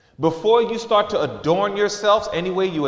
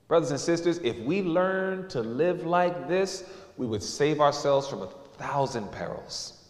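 usual speaking voice

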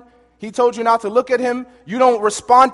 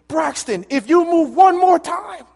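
drill instructor shout; 6 semitones higher than usual. Retold or imitated. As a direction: yell the warning at a fidgety recruit.

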